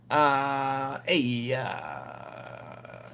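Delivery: a hint of Ed, all hems and uhs; imitating Ed's halting voice. Mimicking the old manager Ed on the phone, hesitant. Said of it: "that's how he talked".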